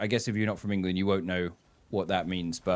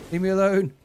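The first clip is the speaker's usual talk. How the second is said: high, loud frightened cry; scared plea; 9 semitones higher, 6 dB louder than usual. Real, not acted. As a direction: beg the monster to go away, panicking. Scared while playing horror game.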